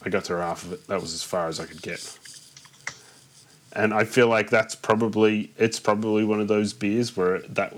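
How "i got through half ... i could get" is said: lower, softer retold admission; acted underwhelmed reply. Someone admitting they couldn't finish the beer, retold as underwhelmed. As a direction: drop down low and quiet for the other person.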